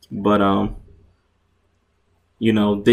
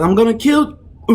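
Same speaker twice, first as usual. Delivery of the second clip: high, loud roaring threat; raging threat; 12 semitones higher, 6 dB louder than usual. Acted. Fighter jumps back in screaming a threat at Terrell. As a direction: scream it as he charges in. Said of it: "He says".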